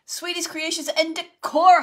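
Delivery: high and loud, the name called out with delight; thrilled shout-out to a friend who just joined; excited shout-out. Real, not acted. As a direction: call the friend's name out happily. Greets a friend on the live.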